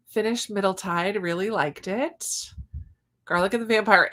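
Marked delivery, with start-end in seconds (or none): none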